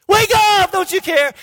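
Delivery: high, loud cry of alarm; frantic plea. Retold or imitated. Disciples waking Jesus in the storm, frantic. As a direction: shout to rouse a sleeper, panicked.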